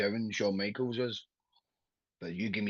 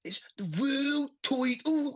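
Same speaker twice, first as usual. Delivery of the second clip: high, slow mock Liverpudlian; hammed-up Liverpudlian catchphrase; Liverpudlian accent; slower than usual. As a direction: exaggerate the Scouse vowels, pitched way up. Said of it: "the only thing he says in that accent".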